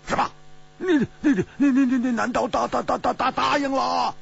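high, rapid, stammering disbelief; loud, flustered demand. A narrator's reading. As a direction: stammer it out in a panicked high cry. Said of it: "大声地说".

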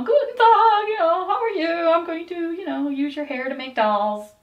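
put-on high, loud, sing-song German accent; a jolly German toymaker greeting you; German shopkeeper accent. Recalling the cheery German doll maker, sing-song German accent. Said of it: "reminds me of the shopkeeper in Frozen".

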